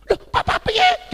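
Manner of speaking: high, loud mock surprise; startled. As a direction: imitate a friend taken aback. Friend startled at mention of dad.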